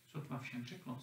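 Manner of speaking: ordinary delivery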